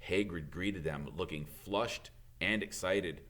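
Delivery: ordinary delivery, nothing marked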